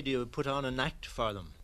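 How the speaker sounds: ordinary talk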